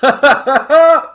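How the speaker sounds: high, loud, laughing delivery. Real, not acted. Tickled by co-host's orc idea, laughing hard. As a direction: barely get it out through laughter. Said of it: "I'm laughing at you".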